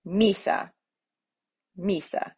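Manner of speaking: "misa" as MEE-sa, falling away; stress on first syllable. Shown as a demonstration. Teacher showing penultimate accent, "misa" stressed on MI. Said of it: "the first".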